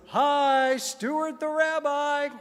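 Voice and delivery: high, long drawn-out singsong greeting; Stuart Federow. Retold or imitated. Rabbi greeting visitors as they walk in, exuberant impression. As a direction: stretch every word in a high, hammed-up voice. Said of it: "do my Stuart Federow impression".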